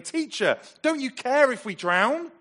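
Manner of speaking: high, loud pleading shout; frustrated, desperate cry. Acted. Disciples waking Jesus in a sinking boat, frustrated and desperate. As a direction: cry out desperately over the storm. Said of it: "in their frustration and desperation".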